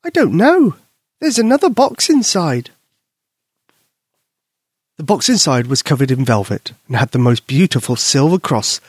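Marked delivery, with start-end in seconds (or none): 0.0-2.7 put-on high, louder excited child; puzzled and thrilled; high excited girl. Susie opening the box, high excited voice.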